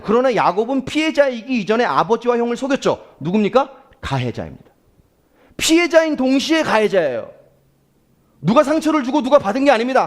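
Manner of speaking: usual speaking voice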